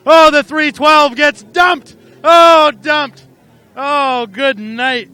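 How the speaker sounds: loud, high, slowed-down yell; announcer howling at a rollover; excited yell. Real, not acted. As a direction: cry out as the truck flips. Truck dumped on track.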